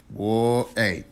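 usual speaking voice

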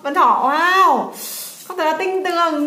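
slow, drawn-out, delighted wow; excited praise. Real, not acted. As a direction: stretch it out in big delight. Praising child's correct guess.

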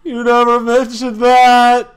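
slow, loud, high outcry; outraged shout. Acted. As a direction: yell it in disbelief. Character's outraged reaction.

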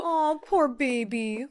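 slow, taunting fake pity; mocking. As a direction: drag it out to mock her. Mocking girl's distress.

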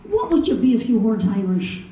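questioning Irish lilt; Irish accent. Retold or imitated. Asking Paddy in the corner, Irish accent. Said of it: "this is over in Ireland".

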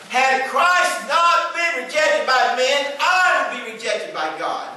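high, slow preaching climax. Real, not acted. Pastor to congregation, high and slow preaching climax. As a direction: lift it slowly like a revival preacher.

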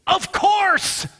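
loud, high-pitched burst of realization; exuberant exclamation. Acted. The redeemed speaking their first words in heaven, exclaimed loudly.

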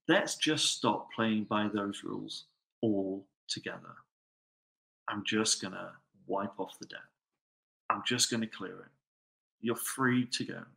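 king tenderly telling the debtor he is free; gentle king. Retold or imitated. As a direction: set him free softly. King wiping the debt.

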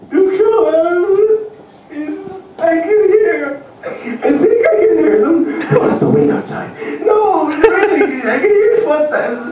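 very high, slow, laughing panic; panicky parent. Retold or imitated. Worried parent who can't hear the child's footsteps, shrill with fear. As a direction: squeak it out in nervous fear.

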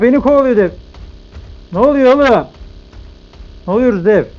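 panicked yelling while fleeing; being chased. Real, not acted. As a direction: shout in alarm, running from something. Chased by a giant in game. (kovalanırken panikle bağırma)

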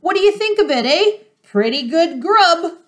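high-pitched, gleeful showing off; excited cry. A narrator's reading. Mister Fox asking what they think of the food, excitedly. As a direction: pitch it high, eager for their approval. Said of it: "dancing up and down".

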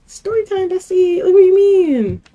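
high, loud outburst; loud exasperated. Real, not acted. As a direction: shriek it in disbelief.

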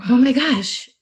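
like you can't believe who's in front of you; awestruck gasp. Retold or imitated.

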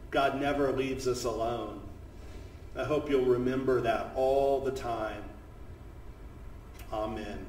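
hushed closing words after a story; gentle reflection. Real, not acted. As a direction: reassure the listeners quietly, settling down.

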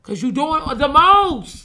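loud, high, slow emphatic retort. Real, not acted. To Flip in a heated argument, slow loud retort. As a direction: drag each word to make the point.

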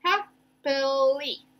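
"Happily" slow, segmented; syllable by syllable. Shown as a demonstration. Teacher recapping the breaks, "Happily" slowly by syllable. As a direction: stretch "Happily" into three beats. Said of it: "my next syllable".

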